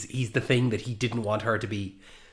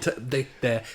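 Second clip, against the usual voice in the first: normal talking voice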